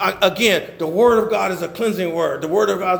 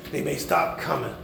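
usual speaking voice

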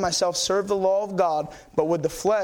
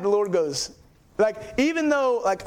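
usual speaking voice

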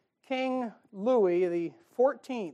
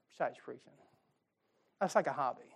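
usual speaking voice

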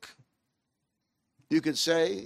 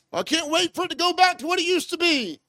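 much higher mock whine; whiny complainer; 9 semitones higher, faster than usual. Preacher mocking listeners stuck in the past, high mock whine. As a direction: lampoon someone pining for the past.

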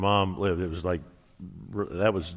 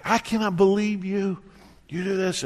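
high, exasperated retold outburst; fuming; 9 semitones higher than usual. Husband to wife after a long wait, fuming. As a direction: reenact a husband's fed-up scolding, pitched up. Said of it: "I, I am fuming".

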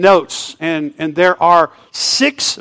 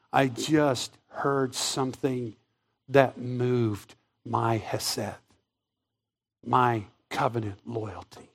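softer, slow and hushed; God speaking softly; 7 semitones lower, 12 dB quieter, much slower than usual. Pretend. God tells a seraph what moved him, hushed and slow. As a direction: murmur it as if deeply moved. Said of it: "he says to them".

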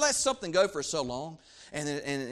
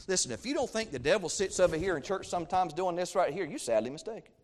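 ordinary delivery

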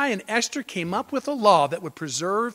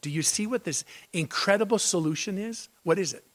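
ordinary talk, nothing marked

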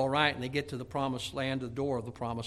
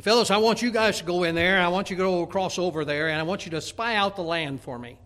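loud, raised orders to the men; commanding; 4 semitones higher, 8 dB louder than usual. Retold or imitated. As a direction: send them out like a leader addressing troops. Acted Moses sending out the spies.

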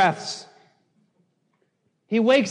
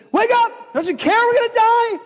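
high, panicked shouted plea; freaking out; 13 semitones higher than usual. Retold or imitated. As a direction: cry out as terrified disciples waking their master. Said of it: "the disciples are freaking out".

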